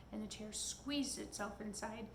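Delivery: ordinary delivery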